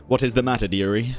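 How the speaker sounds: ordinary delivery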